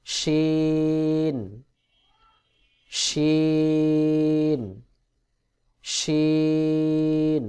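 শিন with sh from mid-tongue at the palate; letter demo. Shown as a demonstration. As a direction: stretch শিন very long on one level note. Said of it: "তালুর সঙ্গে লাগাইয়া শিন উচ্চারণ". (জিহ্বার মধ্যখান তালুতে লাগিয়ে টেনে শিন)

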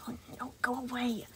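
unvoiced, soft hiss of a plea; whisper under breath. Animatronic whispering at an inner voice while bandaging the boy. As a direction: whisper to the voice in your head. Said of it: "whispered under his breath".

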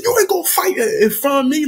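squeaky mock-stern father; high stern dad. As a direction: scold from up high. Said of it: "he said".

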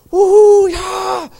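high, loud woo-hoo like a football fan; mock the giddy soldiers' cheer; retold stadium whoop, pitched high; imitated giddy cheering. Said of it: "screaming and".

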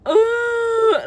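sudden crying. Retold or imitated. Overwhelmed while driving with music, sudden crying gasp. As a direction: gasp that breaks into a high, loud crying oh. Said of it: "all of a sudden I'm like".

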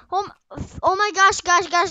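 loud, high burst of alarm; flustered, urgent outcry; panicking. Real, not acted. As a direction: call out urgently to the viewers. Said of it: "I'm panicking".

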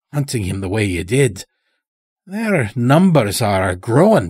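put-on sly old-timer with an unplaceable accent; Morris's strange accent. A narrator's reading. Chained old stranger talking to the lodge master, in an odd accent. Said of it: "spoke in such a strange way".

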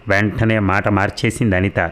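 usual speaking voice

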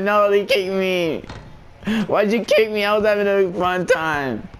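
high-pitched, dismayed complaint; whining protest. Real, not acted. Streamer protesting being kicked from his fun, whining. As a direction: wail it like a wronged player.

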